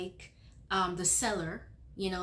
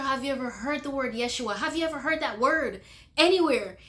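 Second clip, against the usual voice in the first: high, raised, urgent questioning; insistent questioning; 5 semitones higher, 7 dB louder, faster than usual. Retold or imitated. Questioning the puzzled old lady, insistent. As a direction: press for an answer, building louder.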